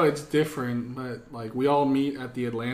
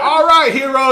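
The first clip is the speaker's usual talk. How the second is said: put-on high, loud Caribbean-accented drill sergeant; hammed-up Caribbean sergeant's bark; imitated Caribbean accent; 9 semitones higher, 12 dB louder than usual. Caribbean drill sergeant addressing a recruit, imitated accent. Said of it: "he had this fucking accent".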